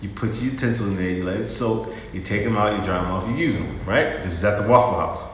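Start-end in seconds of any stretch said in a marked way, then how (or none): none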